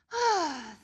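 a long, weary sigh; tired sigh. A narrator's reading. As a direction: sigh like a sleepy pig. Pig grows tired.

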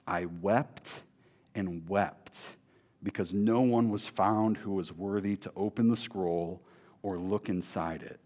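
sorrowful reading. Acted. Reading John's grief quietly.

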